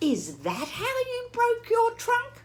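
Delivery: curious Piggy. Acted. Piggy asks Gerald if that's how, high and curious. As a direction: squeak it like an eager piglet.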